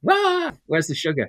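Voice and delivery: high, loud comic outburst; mock frenzy. Storyteller joking after the kids went nuts, mock frenzy. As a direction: cry out in a pretend frenzy.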